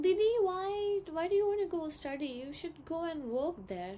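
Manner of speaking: high, quick, mock-puzzled young guy; junior's puzzled question. Junior questioning her plan.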